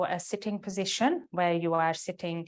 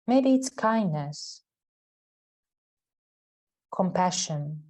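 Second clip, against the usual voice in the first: guided meditation. Real, not acted. Yoga teacher guiding students into the heart center, guided meditation. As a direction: lead them inward, leaving space after each phrase.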